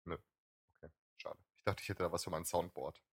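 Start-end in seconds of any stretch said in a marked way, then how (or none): none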